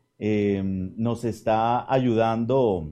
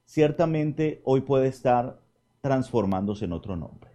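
ordinary talk, nothing marked

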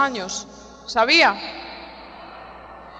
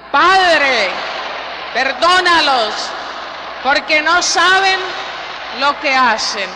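even louder, so the radio hears it; louder shout; 3 semitones higher, 4 dB louder than usual. Shown as a demonstration. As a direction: push it out at full volume. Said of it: "Una vez más fuerte para que nos oigan desde la radio".

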